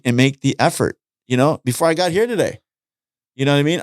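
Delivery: ordinary delivery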